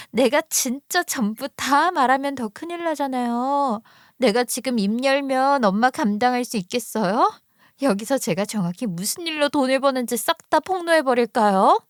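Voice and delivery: acted daughter standing up to mother; defiant daughter. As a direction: threaten your mother with exposure, without shouting. Narrator voices angry sister-in-law.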